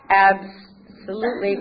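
slow, loud, stretched-out word; drawn-out assent. Retold or imitated. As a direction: stretch it out generously. Voice grants the request.

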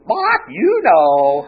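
loud, very drawn-out squawk; stretched, high parrot call for the punchline. Acted. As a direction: squawk it slowly and loudly like a parrot. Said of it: "She goes".

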